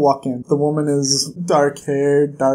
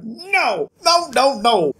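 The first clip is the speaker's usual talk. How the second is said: high exasperated no; 6 semitones higher, slower than usual. Real, not acted. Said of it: "I'm just like".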